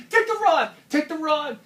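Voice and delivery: high, loud urgent order; urgent shout. Retold or imitated. Jerry caught between rocks, shouting urgently at John. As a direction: bark it at him while you're about to fall. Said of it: "He's like".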